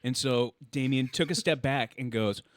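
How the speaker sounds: normal talking voice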